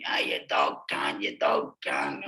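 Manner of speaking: low, slow jeering shout; prisoners yelling. Retold or imitated. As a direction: yell it down from the upper wing, slow and low. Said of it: "blokes up a two wing".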